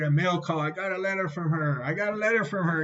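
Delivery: acting out giddy good news; excited. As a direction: relive the thrill of getting mail. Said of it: "I was so excited".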